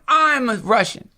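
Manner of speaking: smug patriot. Retold or imitated. Mocking people who claim a national identity, in a high proud voice. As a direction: parody someone proudly declaring their nationality. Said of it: "some sense of like".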